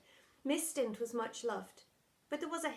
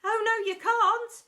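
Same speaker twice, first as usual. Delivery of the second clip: high, loud chant; the entire school chiming back at the deputy head; whole school chiming; 12 semitones higher, 11 dB louder, slower than usual. Acted. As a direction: chime it like a crowd. Panto call and response.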